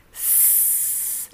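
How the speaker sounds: a long voiceless hissed S. Shown as a demonstration. Teacher demonstrates the unvoiced S to learners. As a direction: hiss a sharp S. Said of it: "una S doble francesa".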